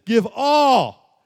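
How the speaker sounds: the correct version, full-force preaching; emphatic command. Acted. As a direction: proclaim it as the full demand. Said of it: "No, he says".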